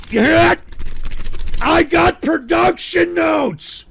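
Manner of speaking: high, loud, slow yell; yelled outburst. Real, not acted. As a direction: blast it out in mock fury. Exploding at chat's request.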